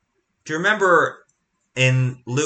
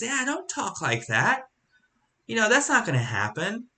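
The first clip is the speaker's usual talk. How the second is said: lightly voiced Peter, higher, brushing it off; a hint of Peter waving off bad news; Peter reassuring; 9 semitones higher than usual. Peter brushing off Jesus' talk of dying, lightly voiced and higher. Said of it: "the same attitude that Peter had".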